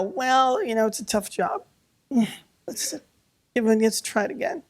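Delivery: high, offhand sarcastic voice; mocking excuse. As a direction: parody someone shrugging off a disaster. Mocks excuses for fatal mistakes.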